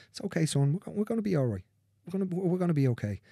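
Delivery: lightly voiced father comforting his son; gently reassuring father. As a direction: soothe a grieving boy.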